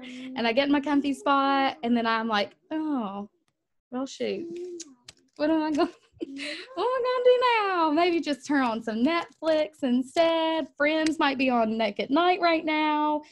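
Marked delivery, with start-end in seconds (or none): 2.7-13.2 high, laughing, talking herself out of work; lightly hammed excuse-making; mock procrastinator. Coach acting out her own procrastinating self.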